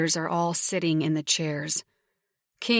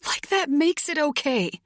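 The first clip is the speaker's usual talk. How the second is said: yell; 9 semitones higher than usual. A narrator's reading. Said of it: "I yell".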